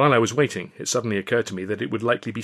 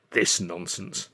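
slow, low, weary grumble; sighing, put-upon tone; long-suffering; 4 semitones lower, 5 dB quieter, much slower than usual. A narrator's reading. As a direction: say it softly, dragged out with resignation. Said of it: "Alf gave a long-suffering sigh".

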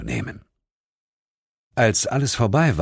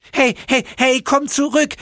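high, loud, furious shouting; angry yelling; 3 dB louder than usual. Acted. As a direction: yell after the fleeing demons. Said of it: "schrie der Höllenengel ihnen wütend hinterher". (wütend hinterherschreiend)

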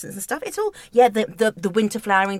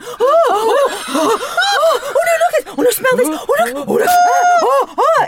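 high, loud, laughing oh-ing over roses; swooning gasps; 8 dB louder than usual. Retold or imitated. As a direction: gush like a crowd of thrilled women. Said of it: "a chorus of middle-aged women just going".

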